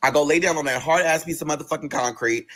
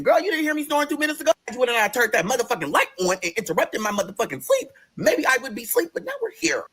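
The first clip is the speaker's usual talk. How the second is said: high, incredulous rant; indignant protest; 4 semitones higher than usual. Retold or imitated. Patient woken by nurse for sleep medicine, indignant. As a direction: argue back with exaggerated offence, pitched up.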